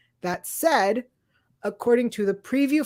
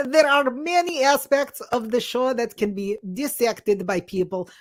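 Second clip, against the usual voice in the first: slightly raised, put-on Russian accent. Co-host reads listener's five-star review, put-on Russian accent. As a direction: read it out in a hammy Russian accent. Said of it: "as though this is how I talk".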